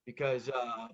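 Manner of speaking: normal talking voice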